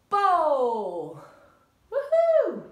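loud long call, then high whoop; bowling cheer. Shown as a demonstration. Coach cheering the class's bowl, loud then whooping high. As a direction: call the throw and celebrate it.

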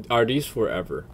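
ordinary talk

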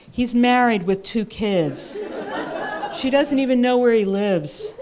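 can't hold back the laughter; amused disbelief breaking into laughs; laughing. A narrator's reading. Absurd story, laughing.

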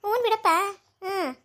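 high mocking "ಹ್ಞೂ ಬಿಡಪ್ಪ", brushing him off; sarcastic scoff. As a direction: scoff at him, you don't believe a word. Aunt scoffs at nephew's invitation. (ವ್ಯಂಗ್ಯದ ಧ್ವನಿ)

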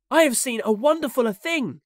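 high, loud, full of wonder; excited boy's cry. A narrator's reading. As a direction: announce a marvel as a thrilled boy. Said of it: "he cried".

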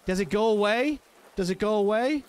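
high, loud frightened questions; panicked. Real, not acted. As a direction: search for the bug in real panic. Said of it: "I'm panicking".

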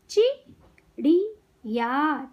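चिड़िया very slowly in three separate parts; syllable by syllable. Shown as a demonstration. Teacher teaching kids to read, syllables apart. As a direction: break चिड़िया into चि - ड़ि - या. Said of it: "शब्द पढ़ना सिखाऊंगी".

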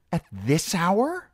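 high, drawn-out indignant question; snapped. A narrator's reading. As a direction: snap it in haughty disbelief. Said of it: "snapped Circe".

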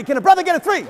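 loud, high call to the crowd; rally the room for a third clap. Real, not acted. Pastor calling out to the congregation, loud and high.

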